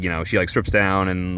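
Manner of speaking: ordinary talk, nothing marked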